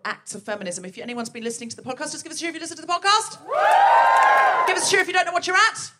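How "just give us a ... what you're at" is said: loud, fast, higher call to the audience; rally the room; calling out to crowd. Real, not acted. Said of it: "host calls for audience cheers".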